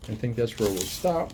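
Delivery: ordinary delivery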